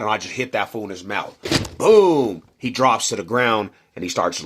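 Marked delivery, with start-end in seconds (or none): 1.8-2.4 one loud, high boom; mimicked punch. Telling how he punched Paul, loud mimicked punch boom. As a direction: smack it out like the hit itself.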